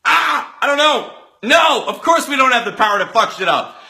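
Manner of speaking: high, loud, startled protest; mock-panicked group reply; alarmed refusal.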